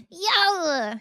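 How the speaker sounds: loud high yell of delight; excited shout. Real, not acted. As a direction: shout it out happily. Excited at chat gift.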